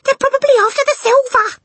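very high, loud, shrieky order; shrill at him to act; urgent panicked hiss. A narrator's reading. Mother orders husband after burglars, shrill and frantic. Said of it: "hissed the mother".

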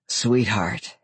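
sighing and low; sighed. A narrator's reading. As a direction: let it out on a sigh. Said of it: "his voice a sigh".

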